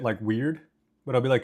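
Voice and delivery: normal talking voice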